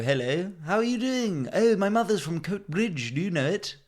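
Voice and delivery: high, sing-song chirpy hello; mock outsider greeting. Half-Scot introducing himself to Scottish locals, high and over-eager. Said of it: "And then I go".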